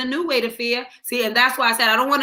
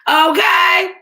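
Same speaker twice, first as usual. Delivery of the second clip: high, louder emphatic okay; 8 semitones higher, 6 dB louder than usual. Acted. Poet punctuates the poem's end loudly. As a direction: land it with a punchy okay. Punchline after the poem.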